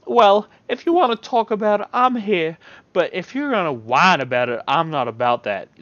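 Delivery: high, loud firm voice; Yolanda's voice. Acted. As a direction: set a boundary as Yolanda.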